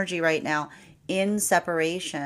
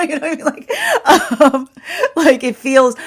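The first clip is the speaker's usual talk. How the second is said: laughing; 12 semitones higher, 8 dB louder than usual. Real, not acted. Reader cracks up at own story.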